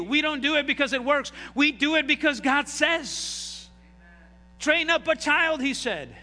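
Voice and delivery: loud, high-pitched preaching, pressing each point; preaching at full force. Acted. Pastor preaching on child rearing to the church, loud and high. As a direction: drive it home to the congregation.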